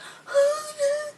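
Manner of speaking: very high-pitched gasp; mock panicked gasp. He is challenged and runs off, mock gasp. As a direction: gasp high, imitating someone about to bolt. Imitates his reaction before running.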